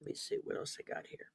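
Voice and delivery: whispered aside, fast and high; muttering to herself. Real, not acted. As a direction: mutter it under your breath. Muttering to herself, searching notes.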